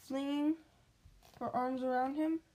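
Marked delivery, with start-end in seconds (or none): none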